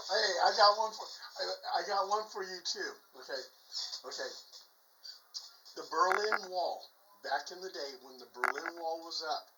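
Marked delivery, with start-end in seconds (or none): none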